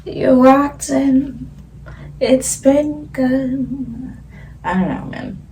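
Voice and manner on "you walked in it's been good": slow, high, stretched oh my God; overwhelmed slow exclamation; drawn-out gasp. Acted. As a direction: stretch it out in awe.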